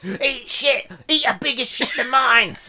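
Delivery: goblin retort. Acted. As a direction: throw it back like a cheeky goblin.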